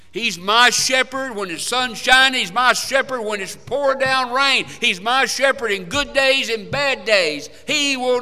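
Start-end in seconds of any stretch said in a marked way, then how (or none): none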